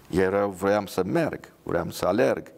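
ordinary delivery, nothing marked

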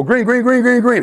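high repeated shout of green; calling out. Retold or imitated. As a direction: ring it out to the whole line. Quarterback calling play at line.

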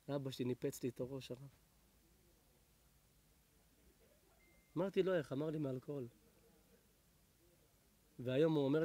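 soft, low retelling of a sad story; hushed storytelling. A narrator's reading. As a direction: draw the listeners in quietly.

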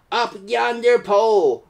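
high, loud pirate shout. Acted. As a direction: shout it up to the mast. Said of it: "another shouted".

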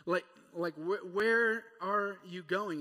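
drawn-out puzzled question; slow down and stretch the question; slow puzzled repeat. Acted. Repeating Peter's question slowly.

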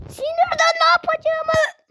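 high, strained exasperated squeal. Real, not acted. Gamer squealing in frustration at the level. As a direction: squeal it out, fed up with the game. Raging at hard game level.

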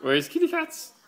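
high sing-song question. Real, not acted. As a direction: ask it brightly, up high. Asks toddler in sing-song voice.

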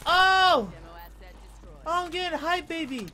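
high, excited shouts; real hype building to a long yell; getting hyped. Real, not acted. Celebrating a growing kill streak.

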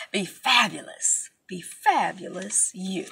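slow, breathy whispered catchphrase; whispered sign-off. Real, not acted. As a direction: whisper the tagline slowly.

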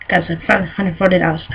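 ordinary talk, nothing marked

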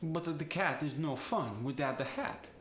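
quick Italian accent, "cat" and "but" on ah; bouncy Italian lilt with ah in "cat", "fun", "hat". Shown as a demonstration. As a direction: say it fast in Italian, uh after "But". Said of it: "get the ah sound".

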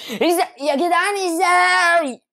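high, loud, drawn-out cry of disbelief; loud stretched wail of frustration; exasperated shout. Real, not acted. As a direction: yell it at the screen, stretched out. Frustrated gamer losing.

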